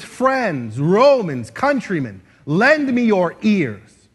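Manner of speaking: loud, drawn-out public proclamation; declaimed oration. Acted. Mark Antony to a huge group of people, declaiming. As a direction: call out to seize the crowd's attention. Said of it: "he's trying to get their attention".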